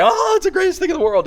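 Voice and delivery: put-on high thrilled kid voice; excited kids. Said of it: "And they".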